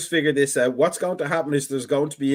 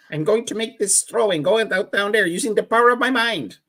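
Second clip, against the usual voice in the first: Uri Geller impression; 7 semitones higher than usual. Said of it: "That's how he sounded".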